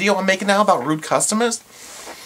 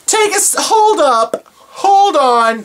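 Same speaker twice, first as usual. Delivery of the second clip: higher, louder imagined snap at the customer; blowing up in her head, hammed up; imagined outburst; 10 semitones higher, 5 dB louder, slower than usual. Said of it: "This is in my head, not in real life".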